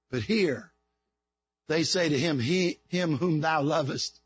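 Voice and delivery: quiet tender retelling of Lazarus; hushed sermon storytelling. Acted. Preacher drops to intimate storytelling.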